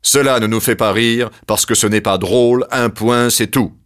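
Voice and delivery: loud, cutting verdict; curt Zeus. Acted. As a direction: slam the door on it. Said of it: "trancha Zeus".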